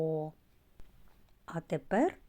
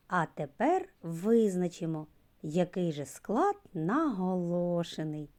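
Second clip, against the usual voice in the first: ordinary delivery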